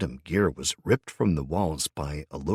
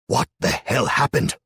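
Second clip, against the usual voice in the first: loud, high furious demand; angry demand; 7 semitones higher, 7 dB louder than usual. A narrator's reading. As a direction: bark it at the prisoner. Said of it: "demanded Mustache".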